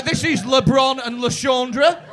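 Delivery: slow, loud mock announcement; hammed-up introduction; proud couple introduction. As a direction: present the names with a shouted flourish. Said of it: "but it's like you two going".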